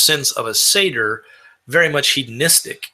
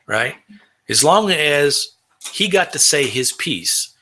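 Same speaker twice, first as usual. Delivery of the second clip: usual speaking voice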